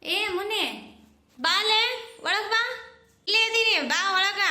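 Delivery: high, loud call to the door; welcoming shout to the neighbour at the door. Acted. Calling neighbour inside.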